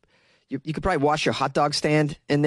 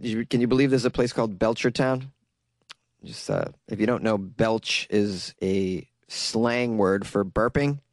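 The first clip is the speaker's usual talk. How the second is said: ordinary talk, nothing marked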